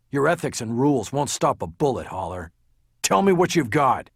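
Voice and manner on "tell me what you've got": raised voice, high and forceful; angry quarrel. A narrator's reading. Lawyer tells detective to do his job, angry. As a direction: snap back louder and higher.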